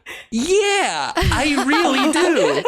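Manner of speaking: high, slow, squeaky eager voice, breaking into a laugh; laughing through a high cartoonish craving; squeaky mock Adam. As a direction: ham up a desperate smoker, squeaking it out. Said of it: "And Adam goes".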